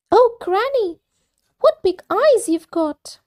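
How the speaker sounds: put-on wide-eyed little girl; little girl amazed. Girl wonders at the wolf.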